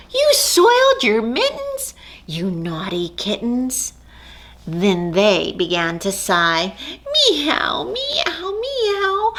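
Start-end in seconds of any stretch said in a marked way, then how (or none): 0.1-3.9 a sudden much higher shocked cry on you; mother gasping in disbelief; shocked mother cat. Acted. Mother cat scolds kittens. 7.3-9.3 high rising little meows; kitten mews. Acted. Kittens mewing sadly.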